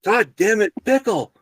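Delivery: loud, high, fed-up shout; exasperated yell. Retold or imitated. Worker finally yelling at the manager in frustration. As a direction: lose patience and bellow the name. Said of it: "Finally, I yell one more time".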